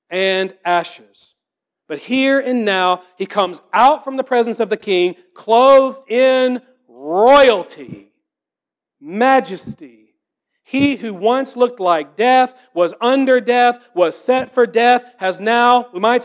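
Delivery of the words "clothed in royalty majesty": slow and grand. Real, not acted. Preacher savours a triumphant image.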